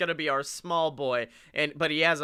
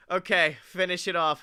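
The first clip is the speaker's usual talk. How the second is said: ordinary delivery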